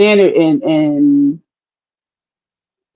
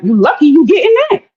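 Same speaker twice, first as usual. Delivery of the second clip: higher, quick imitation of a dismissive man; mock dismissive retort; 9 semitones higher, faster than usual. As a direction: toss it off fast and high, imitating him. Voices dismissive men's attitude.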